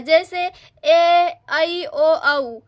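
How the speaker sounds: ए, ऐ, ओ, औ each held very slowly; drawn-out vowels. Shown as a demonstration. Teacher sounding out the compound vowels very slowly. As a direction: stretch every vowel ए ऐ ओ औ long and slow. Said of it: "ये हो जाएगा संयुक्त स्वर".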